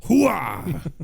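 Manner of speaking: high, loud gung-ho soldier cry; Al Pacino. Acted. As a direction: belt it like a fired-up colonel. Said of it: "Al Pacino's a little bit more up here".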